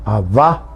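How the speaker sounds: "abba" as ab-BA, final A stressed; "abba" stressed at the end, rising tune; stress on last syllable. Shown as a demonstration. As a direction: lift and lean on the last A of "abba". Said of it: "se acentúa en la última A".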